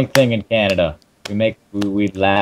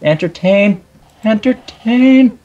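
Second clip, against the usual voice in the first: high, rising hammed-up cry; entertain, building to a shrill shout; mock commanding shout; 6 dB louder, much slower than usual. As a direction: bellow it like a ringmaster ordering the show. Commanding partner to entertain viewers.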